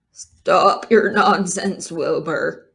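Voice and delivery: hint of a disgusted elder; scornful old sheep. Oldest sheep scolding Wilbur, lightly voiced disdain.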